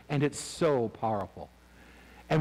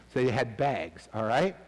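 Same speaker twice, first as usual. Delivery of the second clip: ordinary talk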